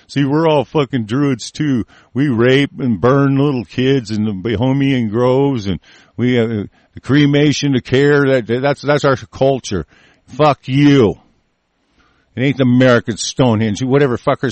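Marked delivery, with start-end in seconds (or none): 0.1-9.9 mocking mock-confession; sarcastic rant. Mocks the Stonehenge label with sarcasm. 10.3-11.2 loud, defiant outburst; angry curse. Real, not acted. Curses those he rants against.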